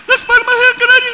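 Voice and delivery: very high, loud metallic call. Shown as a demonstration. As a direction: call it out across the mountains. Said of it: "El eco de una montaña".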